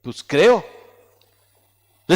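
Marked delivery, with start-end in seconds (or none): 0.0-0.7 half-hearted, noncommittal "creo"; unsure "creo". Acted. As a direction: say "creo" low with a doubtful rise at the end. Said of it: "así como que".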